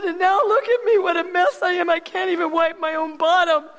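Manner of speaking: high, tearful ranting at God, half laughing. Retold or imitated. Woman in hospital rants at God, high and tearful. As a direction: pour out a teary rant of hurt and blame. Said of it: "I was just ranting and raving".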